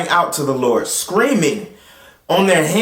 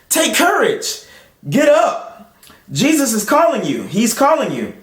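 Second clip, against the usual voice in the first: high, eager call to the blind man; eager urging; 8 semitones higher than usual. Acted. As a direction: lift the voice to rouse him.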